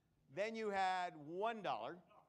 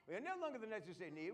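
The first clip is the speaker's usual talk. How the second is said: normal talking voice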